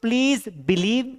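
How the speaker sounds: very slow, word by word, for the class; slow model sentence. Shown as a demonstration. Teacher dictating an English sentence to students, very slowly. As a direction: spell each English word out slowly for learners. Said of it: "यहां पे me आएगा".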